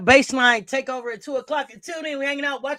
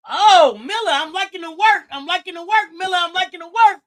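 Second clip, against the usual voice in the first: loud, high approving call; excited praise; 5 semitones higher, 7 dB louder than usual. Real, not acted. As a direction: hype the player up. Praising player loudly.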